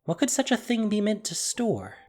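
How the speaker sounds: usual speaking voice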